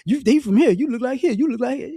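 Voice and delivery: loud mock identification; mimicking West Africans. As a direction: bark it out eagerly like you spotted kin. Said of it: "gonna say".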